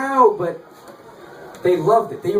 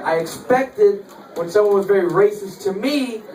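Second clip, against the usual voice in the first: normal talking voice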